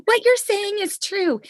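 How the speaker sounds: high, loud and eager agreement; excited. Retold or imitated. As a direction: cheer it out emphatically. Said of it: "be like".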